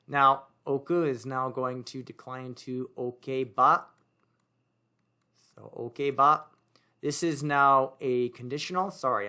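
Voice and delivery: ordinary talk, nothing marked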